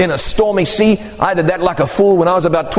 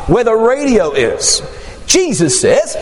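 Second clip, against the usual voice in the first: ordinary delivery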